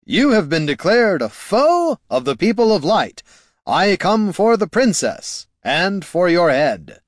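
high, ringing heroic proclamation; heroic knight. A narrator's reading. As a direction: declare it grandly, slowing to the threat. Hero knight challenges the wizard.

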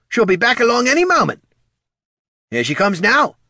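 very high, quick character voice; ghoul's remark. Acted. Ghoul tells Tyron she'll return, high voice. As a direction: pipe up high and lively.

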